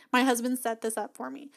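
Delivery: ordinary talk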